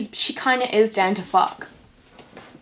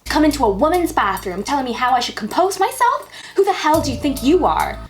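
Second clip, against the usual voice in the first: mock indignant heroine; 5 semitones higher, 3 dB louder, faster than usual. Reading the heroine's angry lines.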